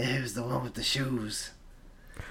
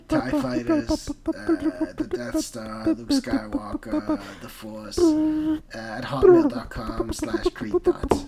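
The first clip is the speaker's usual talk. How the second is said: much higher, slow, squeaky rattling off; 4 dB louder, much slower than usual. Acted. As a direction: read the number in a squeaky cartoon voice. Rattling off absurd phone number.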